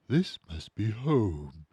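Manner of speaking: a toy finding a home; tender bear voice. A narrator's reading. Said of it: "he said".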